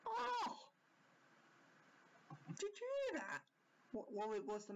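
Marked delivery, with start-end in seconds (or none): 0.1-3.5 sudden high yelp of surprise; startled gasp. Real, not acted. Viewer startled by the show, high surprised yelp. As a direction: jump at a noise and blurt it out.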